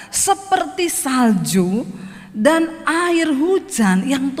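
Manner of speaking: scripture reading. Acted. Preacher to congregation, reading scripture solemnly. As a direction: read the verse with weight, unhurried.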